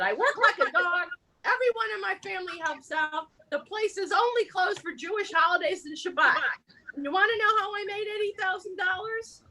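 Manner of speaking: high-pitched, hammed-up grievance; exasperated complaint. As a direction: complain with feeling as a hard-working deli owner. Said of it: "I'll read it with feeling".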